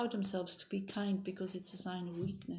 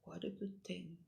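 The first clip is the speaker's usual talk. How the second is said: breathless whispered wow; awed whisper; 13 dB quieter, slower than usual. Retold or imitated. Buddha nature marveling at the punk, hushed whisper. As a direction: whisper it in quiet wonder. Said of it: "to say".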